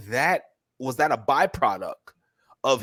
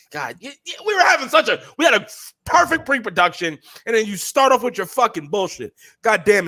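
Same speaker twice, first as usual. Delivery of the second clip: louder, higher exasperated rant; mock outrage; 9 semitones higher, 5 dB louder than usual. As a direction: blow up at your partner, half joking.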